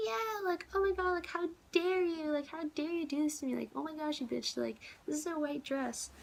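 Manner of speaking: high-pitched, whiny mock outrage; outraged drunk woman. Drunk club-goer scolding her over a spilled drink, mocking high indignation. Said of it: "I was so angry because she was like".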